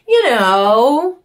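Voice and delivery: incredulous high exclamation; exasperated high squeal. Real, not acted. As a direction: pitch jumps way up in disbelief.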